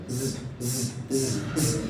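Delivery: ز as a bare, soft buzzing z; isolated z sound. Shown as a demonstration. Teacher showing the new sound of X with Y, soft separate ز buzzes. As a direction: sound out ز alone, softly, four times. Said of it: "عمل صوت جديد خالص، بقى اسمه إيه؟".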